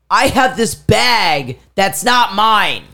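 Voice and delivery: acted-out frantic confession. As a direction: announce it urgently, drawing out each word. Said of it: "I am frantic".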